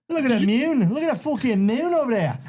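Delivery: very high Irish brogue; high-pitched Irish fighter impression; Conor McGregor. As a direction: exclaim in a hammed-up Irish accent. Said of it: "How about McGregor coming back".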